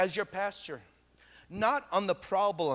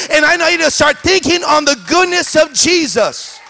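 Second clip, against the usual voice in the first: loud, high preaching crescendo; full-force preaching; 7 semitones higher, 19 dB louder than usual. Acted. Pastor calling the congregation to revival, loud preaching. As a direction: drive it home to the church.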